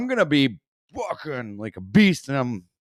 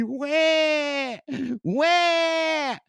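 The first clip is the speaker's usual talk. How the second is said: high hammed-up baby cry "Wah!"; mock baby wail; 12 semitones higher than usual. Mocking the guru with a fake baby wail. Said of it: "crying like a baby".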